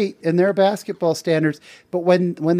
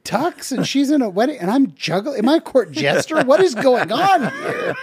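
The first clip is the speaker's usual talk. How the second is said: high, rising incredulity, laughing at the end; baffled friend asking if he's a court jester; mock bewildered; 6 semitones higher than usual. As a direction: pretend you can't believe the request. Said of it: "And I went".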